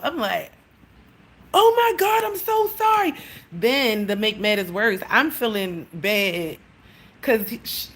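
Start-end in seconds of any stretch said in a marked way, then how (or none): 1.5-3.1 high, loud embarrassed apology; flustered apology. Retold or imitated. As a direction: gush sorry way up high. Apologizing to neighbor about her cat.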